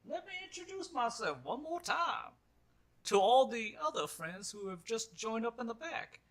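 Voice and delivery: high, put-on old gentleman; old miner's voice. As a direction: address the whole crowd with pomp. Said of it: "And he just goes".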